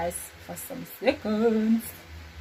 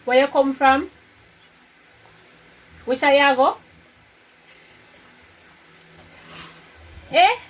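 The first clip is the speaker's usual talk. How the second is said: loud calls, raised pitch; 6 semitones higher, 9 dB louder than usual. Real, not acted. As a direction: call out over the music in your ears. Headphones on, shouting guesses.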